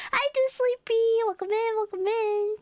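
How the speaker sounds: high sing-out welcome; high-pitched, eager hello; chirpy greeting. Real, not acted. Greets a viewer arriving in chat.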